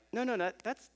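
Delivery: put-on a dad gently protesting to his child; mild protest. Dad arguing gently with small daughter.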